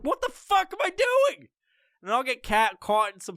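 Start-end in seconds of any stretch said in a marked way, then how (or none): 0.0-1.5 exasperated shout. Retold or imitated. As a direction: shout at yourself catching it. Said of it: "I'm like".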